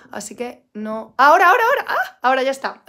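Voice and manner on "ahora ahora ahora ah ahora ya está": excited relief. Real, not acted. Relieved when chat works.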